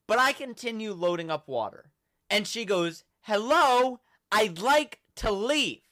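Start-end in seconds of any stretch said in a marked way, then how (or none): 3.2-5.8 mock yell, high and loud, slow at the end. Lady calling out to staff in a mock yell. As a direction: call out to the empty store, slow and loud. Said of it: "Yells it".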